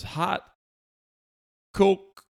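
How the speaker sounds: very high, loud, halting query; rising pitch and volume, pauses between words; struggling non-English speaker. Retold or imitated. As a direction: imitate someone straining to be understood, word by word. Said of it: "And he goes".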